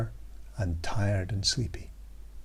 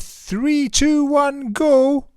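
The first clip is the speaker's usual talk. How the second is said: rising countdown, much higher and louder; countdown shout; 7 dB louder, much slower than usual. Acted. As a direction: build it to a big GO. Children count down to tickle.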